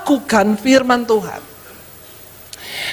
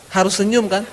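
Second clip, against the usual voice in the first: ordinary talk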